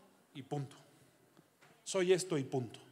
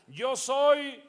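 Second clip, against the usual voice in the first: proud, puffed-up announcement, higher and louder; mock boast; 5 semitones higher, 7 dB louder than usual. Pastor mocking a millionaire bragging in the paper, puffed-up boast. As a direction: brag it like a vain tycoon.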